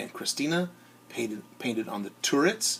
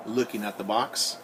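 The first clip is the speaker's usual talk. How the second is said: ordinary delivery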